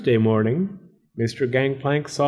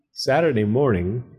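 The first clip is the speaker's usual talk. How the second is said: plain reading voice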